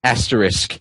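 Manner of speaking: soft, downcast "Asterisk"; sadly. Acted. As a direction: say it with a sigh. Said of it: "he said sadly".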